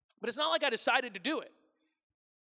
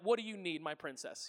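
doting reply; 5 semitones lower, 7 dB quieter than usual. Retold or imitated.

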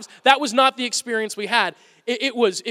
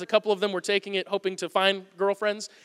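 ordinary talk, nothing marked

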